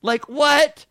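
high, loud sarcastic disbelief; incredulous rant. Retold or imitated. Comedian rants about the Disneyland question, high sarcastic disbelief.

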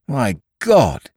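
sudden high cry of shock; cried out. A narrator's reading. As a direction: gasp it out in alarm. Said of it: "cried the atheistic cobbler".

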